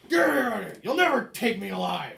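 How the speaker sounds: high, loud growled yell; defiant prisoner. Acted. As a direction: thrash and shout defiance at the guards. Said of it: "And he's like".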